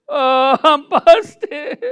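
high, loud laughing "I'm busted"; laughing confession. Retold or imitated. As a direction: caught and giggling. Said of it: "I'm gonna say".

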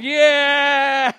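high, loud, long cheer; cheering women. Retold or imitated. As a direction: cheer like delighted women in the hall.

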